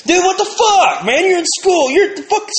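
high, loud shouted mockery with a laugh; cracking up while yelling at a friend; mock outraged yell. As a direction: yell it at your buddy in disbelief.

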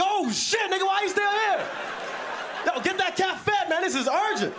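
high-pitched, panicked mock shout; alarmed urgency. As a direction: send him off urgently, voice shooting up. Alarmed mock reaction to the demo.

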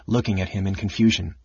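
ordinary delivery

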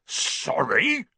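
giant's rising, barked echo; indignant bark. A narrator's reading. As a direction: fire it back as an outraged question. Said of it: "barked Hagrid".

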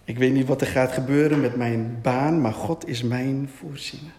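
low, steady recitation of faith; murmured declaration. Shown as a demonstration. Preacher models declaring faith over a job, low murmur. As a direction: pray it low, as a personal declaration. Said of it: "begint te mompelen".

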